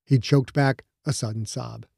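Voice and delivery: ordinary delivery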